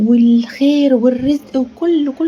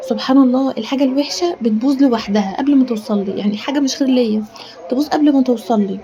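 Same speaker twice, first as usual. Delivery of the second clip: ordinary delivery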